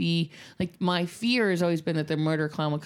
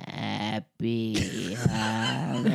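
very low, very slow creepy Happy Halloween; creepy clown; 8 semitones lower, much slower than usual. Retold or imitated. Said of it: "He said it really creepy".

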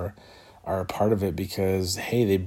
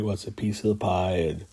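talking through a yawn; words stretched by a yawn. Real, not acted. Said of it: "oh, I'm yawning".

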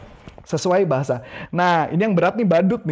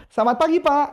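much higher bright selamat pagi; greeting a passerby; 8 semitones higher than usual. Shown as a demonstration. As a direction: greet with a friendly sales voice. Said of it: "saya akan dadain gini dulu". (sapaan ramah penjual brosur)

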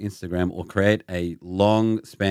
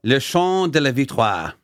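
slow, showy French pronunciation; put-on French accent, drawn out; read in French; 4 semitones higher, slower than usual. Shown as a demonstration. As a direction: declaim the French title with relish. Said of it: "I had to read it in French".

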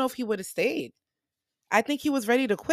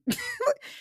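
short high-pitched laugh; a quick squeal of laughter. Real, not acted. Laughing at her own retelling.

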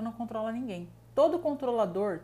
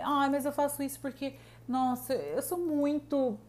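mock whiny victim; 5 semitones higher, slower than usual. Imitating the self-victimizing person.

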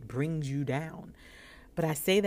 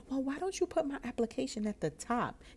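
playful cheeky ask, higher; 8 semitones higher than usual. Retold or imitated. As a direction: tease her with a cheeky request. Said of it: "I got a little cheeky".

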